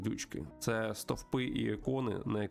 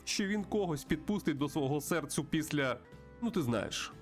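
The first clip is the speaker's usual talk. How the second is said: raised, animated character voice; friendly bird host, pitched up; chatty old friend; 6 semitones higher than usual. Acted. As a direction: talk confidingly to a pal in a higher pitch. Plays friend character at saloon.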